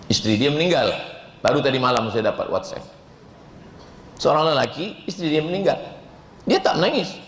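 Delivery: usual speaking voice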